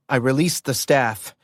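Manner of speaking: ordinary delivery, nothing marked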